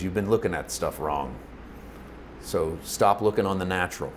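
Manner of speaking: low, soft, matter-of-fact correction; quiet and deep, gently setting someone straight; voice of God. Retold or imitated. God correcting someone waiting around, low and quiet. Imagined God addressing a listener.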